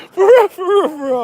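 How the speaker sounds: high, loud, breaking into laughter; laughing insistence. Real, not acted. Laughing hard while telling the listener he means it. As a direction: insist through real laughter.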